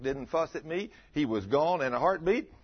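normal talking voice

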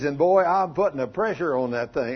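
ordinary delivery

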